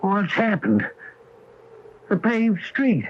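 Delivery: thunderstruck, voice lifted; stunned disbelief. Acted. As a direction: take in each sight with amazement. Shocked at the changed town.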